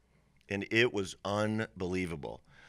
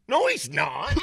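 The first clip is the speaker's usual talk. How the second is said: acted contradiction; 6 semitones higher, 6 dB louder than usual. Wife flatly contradicts husband, acted.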